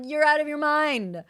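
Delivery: high, loud outburst of disbelief; outraged disbelief. Retold or imitated. Client to coach over month's income price, outraged. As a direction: cry it out high, appalled at the price.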